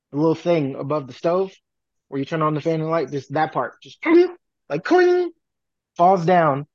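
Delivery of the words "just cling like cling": much higher ringing clang sound; knife clang imitation. Imitating a knife hitting metal.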